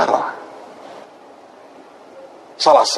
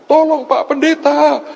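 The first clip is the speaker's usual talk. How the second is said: very high, slow, loud mock pleading; imitated shouted plea; 4 dB louder, slower than usual. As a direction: imitate a desperate woman caller shouting her request. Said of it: "dia teriak dengan saya". (menirukan ibu berteriak memohon, tinggi dan panjang)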